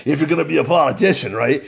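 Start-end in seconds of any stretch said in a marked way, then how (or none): none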